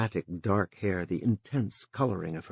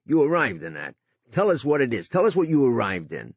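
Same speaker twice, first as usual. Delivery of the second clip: louder, insistent angry demand; 6 semitones higher, 8 dB louder than usual. A narrator's reading. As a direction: press him hard for an answer. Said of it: "Tito Apostol said with anger".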